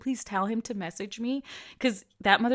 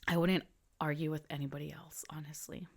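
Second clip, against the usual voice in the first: hushed, confiding whisper; mother whispering a fond confession; 5 semitones lower, 8 dB quieter than usual. Real, not acted. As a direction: lean in and whisper it.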